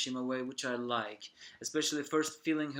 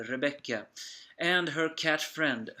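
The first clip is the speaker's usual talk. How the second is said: ordinary talk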